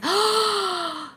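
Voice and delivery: long, high-pitched gasp; big drawn-out intake of breath; excited gasp. Real, not acted. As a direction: gasp with sudden delight. Hears the show is in France.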